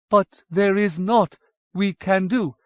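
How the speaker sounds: lightly voiced despairing Dwaro; pleading Dwaro. A narrator's reading. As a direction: plead for understanding, slowly. Ysfidri voiced slow and pleading.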